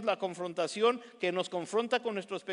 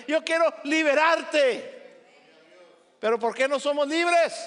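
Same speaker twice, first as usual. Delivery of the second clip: loud, high, impassioned preaching; preaching at full force; 6 semitones higher, 5 dB louder than usual. Acted. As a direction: proclaim freedom, almost shouting. Clímax de la predicación. (predicación a todo pulmón, casi gritando)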